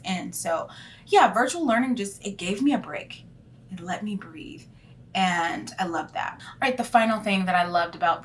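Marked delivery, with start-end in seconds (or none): none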